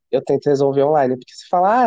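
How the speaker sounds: usual speaking voice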